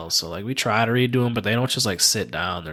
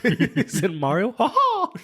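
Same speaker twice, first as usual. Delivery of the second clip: high, laughing cartoon squeak; Mickey Mouse laugh; 12 semitones higher, slower than usual. Guessing a video game character's noise, a high Mickey squeak. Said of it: "that's Mickey, my bad".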